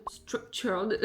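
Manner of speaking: second try at English "Structured" with a clearer t; self-mocked English accent. Shown as a demonstration. Vlogger retrying the app's name, joking English accent. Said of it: "avec mon super accent anglais".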